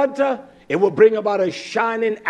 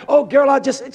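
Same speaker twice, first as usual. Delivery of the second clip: mock sassy woman; 6 semitones higher, 5 dB louder than usual. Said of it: "she thinks she got something".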